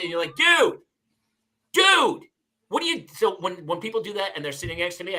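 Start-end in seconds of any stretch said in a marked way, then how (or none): 0.4-3.0 much higher and louder, drawn out in disbelief; exasperated dude. Retold or imitated. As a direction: plead in mock outrage. Annoyed at speakerphone guy.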